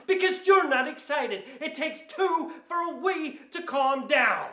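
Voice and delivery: high-pitched indignant retort; exasperated. Acted. Angry customer refusing to calm down, exasperated. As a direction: argue back with pitch pushed up.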